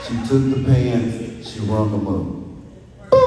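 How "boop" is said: short mouth beep like a register scanner. Retold or imitated. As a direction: imitate the scanner's boop. Cashier scans the pants.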